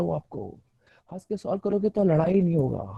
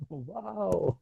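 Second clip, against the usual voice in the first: laughing wow at a missed bus; cheerful wow through a laugh; mock wow; 9 dB quieter, much slower than usual. Shown as a demonstration. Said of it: "आपने बोला". (हँसते हुए वाओ)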